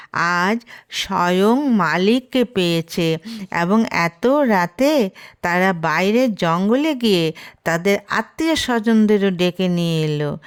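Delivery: ordinary delivery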